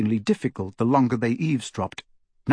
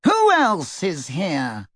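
slow, high, lofty sneer; supercilious; 5 semitones higher, slower than usual. A narrator's reading. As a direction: look down your nose. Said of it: "supercilious eyebrows".

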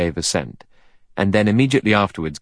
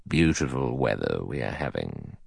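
put-on low, soft, measured old judge; cautious judge; 7 semitones lower, 8 dB quieter than usual. A narrator's reading. Judge makes small talk in taxi, cautiously. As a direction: weigh it like a careful lawyer. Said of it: "with due legal caution".

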